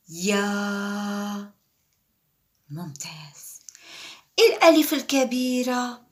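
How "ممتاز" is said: a soft whispered excellent to the pupil; whispered praise. Real, not acted. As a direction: whisper ممتاز softly with a falling close. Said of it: "teacher praises in whisper".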